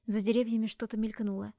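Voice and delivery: usual speaking voice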